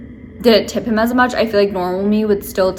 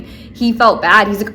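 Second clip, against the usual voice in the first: ordinary talk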